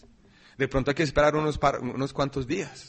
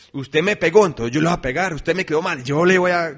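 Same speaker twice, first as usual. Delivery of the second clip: louder, a touch of grudge; mock vengeful quote; 3 semitones higher, 8 dB louder than usual. Believer voicing revenge on an offender, loud mock grudge. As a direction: throw it back like a petty grudge.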